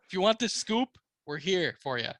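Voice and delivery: usual speaking voice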